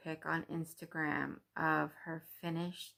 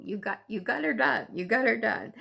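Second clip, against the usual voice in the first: excited cheer; 5 semitones higher, 6 dB louder than usual. Real, not acted. Cheering a viewer's finished piece.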